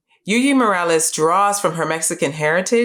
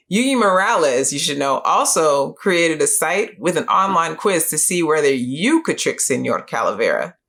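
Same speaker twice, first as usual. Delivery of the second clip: ordinary delivery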